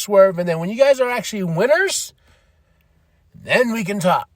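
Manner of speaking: high-pitched, animated brush-off; MJF. Retold or imitated. MJF stalling Hangman and Swerve, animated dismissive taunting.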